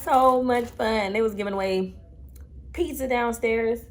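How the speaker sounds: tired hushed chat in hotel room; hushed late-night talk. Real, not acted. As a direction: talk quietly so neighbors won't hear.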